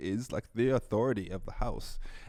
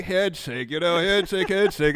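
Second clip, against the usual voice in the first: higher, louder playful insistence; insisting on a handshake; 8 semitones higher, 7 dB louder than usual. Retold or imitated. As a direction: push the handshake cheekily. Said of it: "So I'm just like".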